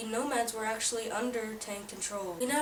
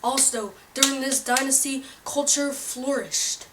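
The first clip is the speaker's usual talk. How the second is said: loud, pushy infomercial pitch; hard sell ad; 3 semitones higher, 9 dB louder than usual. Acted. Pitch continues loudly.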